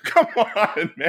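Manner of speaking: ordinary delivery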